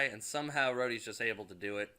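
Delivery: usual speaking voice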